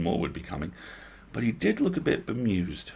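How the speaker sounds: plain reading voice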